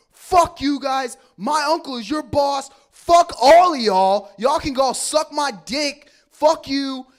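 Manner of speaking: high, drawn-out furious scream; screaming tirade, hitting each word hard; screamed cursing. Retold or imitated. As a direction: curse everybody out at full volume. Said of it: "I just screamed them out".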